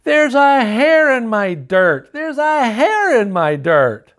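a little worm screaming at his dinner. Acted. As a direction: shriek it out in disgust. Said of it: "suddenly spit out his food and screamed".

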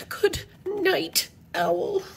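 high, softened, spaced-out words; high, halting good night; strained bedtime good night. Acted. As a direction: say it high and careful, word by word. Owl tries once more to sleep.

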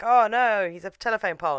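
loud, high "oh no"; mock alarm. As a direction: cry out in hammed-up dismay. Mimics alarm at telephone pole.

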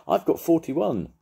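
high, loud eager cry; excited cry. A narrator's reading. Paddington answering the judge, high excited cry. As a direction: burst out with it, thrilled.

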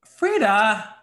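a high, loud whale call. Pretend. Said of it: "give me your best whale call".

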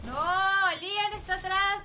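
high, loud team warning; loud callout. Real, not acted. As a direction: call out the enemy position. Callout during fight.

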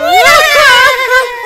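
loud startled laughing yelp; startled shout. Acted. Waking up with a painted face, loud startled yelp. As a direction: burst out in a shocked yell.

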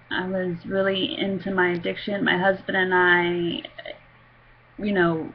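ordinary delivery

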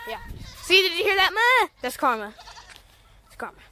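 ordinary delivery

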